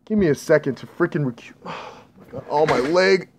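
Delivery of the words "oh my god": breathless unvoiced oh my God; pained whisper. Real, not acted. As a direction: wince through the pain. In pain after falling.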